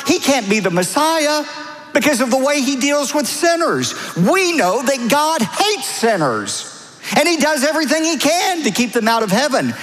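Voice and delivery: high-pitched mock indignation; hammed-up indignant complaint; imitated accusers. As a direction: voice the objectors' accusation, pitched well up. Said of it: "You know what they were really saying?".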